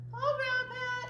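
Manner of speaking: high squeaky puppet greeting; Tiger puppet voice. Pretend. Tiger the puppet greeting Grandma Pat. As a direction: greet her as a bouncy little tiger. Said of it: "Tiger would like to join us today".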